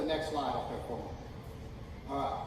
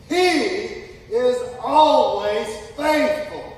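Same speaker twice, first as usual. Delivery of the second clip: slow, loud faith declaration; 4 semitones higher, 9 dB louder, slower than usual. Shown as a demonstration. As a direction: proclaim each line slowly. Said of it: "say this out loud".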